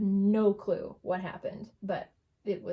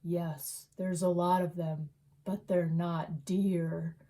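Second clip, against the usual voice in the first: a hint of a creepy cat answering slowly; eerie cat voice; 4 semitones lower, slower than usual. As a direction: lower and very slow, lightly voiced ominous cat. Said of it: "spoke to him and said".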